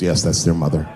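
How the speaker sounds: низкий голос полицейского; deep cop voice. Retold or imitated. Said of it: "He goes".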